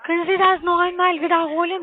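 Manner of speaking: ordinary talk, nothing marked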